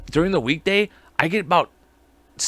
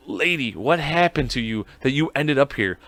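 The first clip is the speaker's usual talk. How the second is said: normal talking voice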